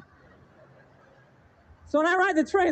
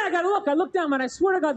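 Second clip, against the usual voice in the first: usual speaking voice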